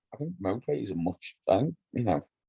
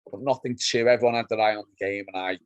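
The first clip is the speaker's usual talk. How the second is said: ordinary talk, nothing marked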